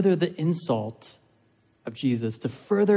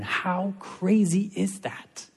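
amazed; 5 semitones higher, slower than usual. Real, not acted. Preacher marvels at grace.

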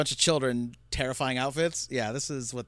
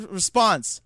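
ordinary delivery

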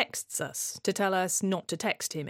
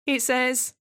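normal talking voice

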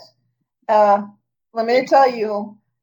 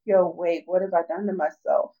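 low, hushed realisation; quiet mock dismay; acted dawning regret; 6 semitones lower, 7 dB quieter than usual. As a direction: drop to a soft, low voice, as if it just hit you.

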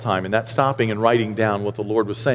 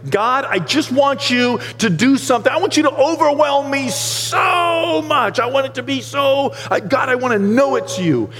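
higher, urgent pleading to God; retold pleading prayer; 12 semitones higher than usual. Pastor reliving his road-trip prayer, eager and pleading. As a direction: beg God to show up. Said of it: "The whole time I just was praying".

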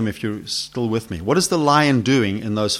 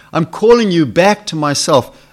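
raised, louder calling voice; insistent call; 6 semitones higher, 7 dB louder than usual. Acted. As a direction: call out firmly, higher and louder. God's call grows more insistent.

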